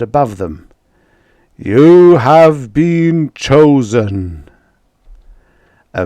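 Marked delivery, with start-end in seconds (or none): 1.6-4.5 slow, loud and higher, like a god speaking; a vast celestial voice announcing the chosen; booming voice from the sky. Acted. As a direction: proclaim it slowly from the heavens. Lightning voice from the sky.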